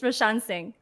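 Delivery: ordinary talk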